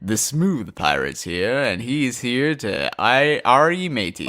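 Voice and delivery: slow, drawn-out smooth crooning; smooth, sultry pirate. Pretend. Co-host demonstrating the smooth pirate for listeners, slow sultry voice. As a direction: play a sultry pirate, taking your time. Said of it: "I can give you some smooth, sultry, low voice".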